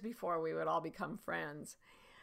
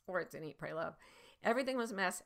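ordinary talk

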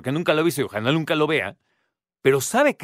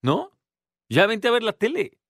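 lightly mock the fuss away, higher; dismissive shrug; 6 semitones higher than usual. Little sister brushing off her crying sister, offhand and higher. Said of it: "Porque es así como".

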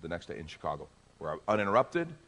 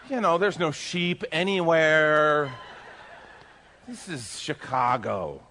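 slow, louder, laughing gripe; laughing complaint; 6 semitones higher, 5 dB louder, slower than usual. Retold or imitated. Pastor half-laughing as he gripes to God, slow.

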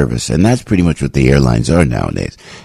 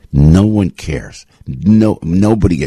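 normal talking voice

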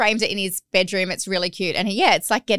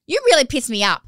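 put-on higher, louder kid voice; imitating cheeky toddler; 4 semitones higher, 6 dB louder than usual. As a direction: deliver it like a proud little boy. Toddler's cheeky line retold.

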